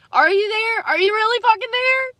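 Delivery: high, desperate plea to God; pleading question. Real, not acted. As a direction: call up to God, begging for an answer.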